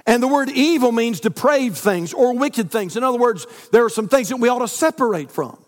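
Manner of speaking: sermon delivery building up; preaching. Acted. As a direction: preach it with growing force. Preacher building to full force.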